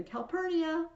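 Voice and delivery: high, loud call to his wife; commanding call across a crowd; yell. Acted. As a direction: shout the name as Caesar. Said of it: "And Caesar yells again".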